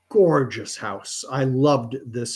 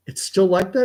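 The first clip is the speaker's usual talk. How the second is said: higher, stunned question; surprised; 7 semitones higher than usual. Retold or imitated. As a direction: ask it in open disbelief. Disbelief at friend's story.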